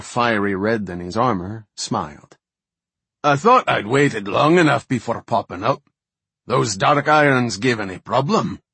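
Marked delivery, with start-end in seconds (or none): 3.2-8.6 higher, louder hearty dwarf; boisterous cheerful dwarf chief; jovial dwarf. A narrator's reading. Jovial Wildhammer dwarf leader speaks.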